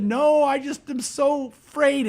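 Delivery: high, drawn-out mock-tearful plea; tearful worry, acted out for a story; retold tearful fear. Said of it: "she's crying. And she said".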